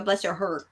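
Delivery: soft, low "Bless your heart" with no final T; Southern "heart", final T left off; T dropped on "heart". Shown as a demonstration. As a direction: let "heart" end without its T, Southern style. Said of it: "it's too hot in the South to say the T".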